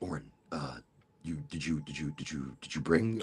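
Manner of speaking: low, soft whispery family voice; hushed relatives. Retold or imitated. Relatives at the potluck quietly asking Oren about weed, low and hushed. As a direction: lean in low and hush it like gossip. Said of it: "they're like".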